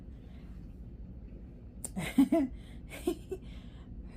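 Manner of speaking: high, breathy burst of laughter; real laugh. Real, not acted. Tarot reader amused by a repeated Cancer card, laughing. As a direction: let a long helpless laugh out. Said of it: "y'all gonna see why I'm laughing".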